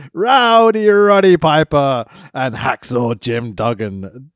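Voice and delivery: slow, drawn-out announcer call; mock ring announcement. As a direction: boom the winners' names. Announcing match winners.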